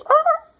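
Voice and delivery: sudden high-pitched, loud yelp; imitated dog yelp. Storyteller imitating the dog upstairs, high loud yelp. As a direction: mimic a dog with a piercing squeal. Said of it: "of course the dog goes".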